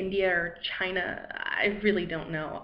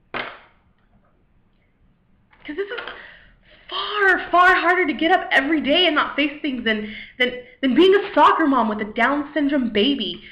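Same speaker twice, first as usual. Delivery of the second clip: loud, higher, exasperated outburst; worked-up venting; 5 semitones higher, 6 dB louder than usual. Acted. Venting to God about facing each day, worked-up. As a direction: complain with force, voice pushed up.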